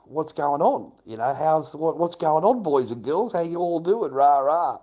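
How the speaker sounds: loud call to a whole group; loud, chatty hello to everyone; acted hearty greeting. Quotes himself greeting the table.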